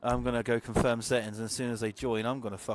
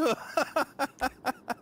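high laugh; 13 semitones higher than usual. Real, not acted. Surprised at becoming host.

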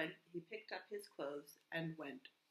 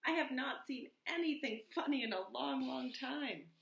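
high, loud mouse voice; excited storybook animal, pitched up; eager field mouse; 6 semitones higher, 6 dB louder than usual. A narrator's reading. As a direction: pipe it out with relish.

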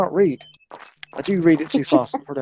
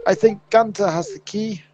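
odd character voice; 3 semitones higher, slower than usual. Pretend. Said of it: "Don't know what that voice was".

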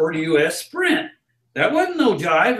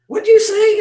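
high-pitched questioning; 5 dB louder than usual. Retold or imitated. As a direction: imitate a puzzled passer-by, high and loud. Passer-by questions the barker's call.

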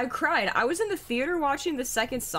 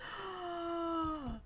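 long, high, soft gasp; mock gasp; 8 semitones higher, 11 dB quieter than usual. Streamer hears friend's dad disliked movie, mock-shocked gasp. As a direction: gasp as if scandalized.

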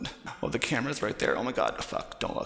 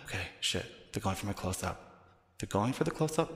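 ordinary delivery

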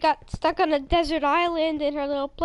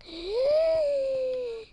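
sudden high gasp of realization; sharp excited gasp; 9 semitones higher than usual. Real, not acted. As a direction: gasp as an idea hits. Excited idea before calling friend.

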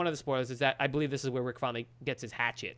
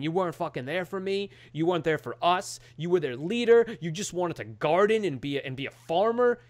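raised, higher-pitched imitation of angry accusations; laying into him; 4 semitones higher, 5 dB louder than usual. Retold son blaming his father, heated scolding. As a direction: act out a son's tirade. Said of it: "he just laces into him".

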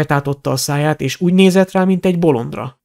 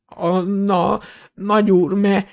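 frightened stutter, slightly raised voice; stammering; 5 semitones higher, much slower than usual. A narrator's reading. Shopkeeper denying the dark lord's return, stammering. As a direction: trip over the words, dragging the last. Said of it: "dadogott Borgin".

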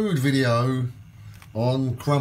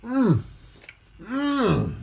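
savouring hum; 10 semitones higher than usual. Real, not acted. Tasting the crumpet on camera.

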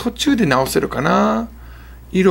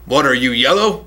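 1 mock western taunt; 3 semitones lower, slower than usual. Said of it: "昔の西部劇とかで".

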